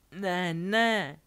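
loud, high lament; wailing. Acted. Defeated Delphi on the floor, wailing. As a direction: wail it out in defeat. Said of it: "bědovala".

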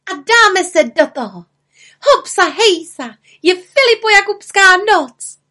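high, loud, gleeful cheer; exultant cry rising to a peak; jubilant calling. A narrator's reading. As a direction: whoop with satisfaction as the little witch. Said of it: "spokojeně zvolala malá čarodějnice".

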